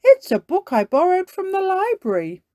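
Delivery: high, loud cartoon dad explaining; Daddy Pig. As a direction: pitch it way up and project. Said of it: "says Daddy Pig".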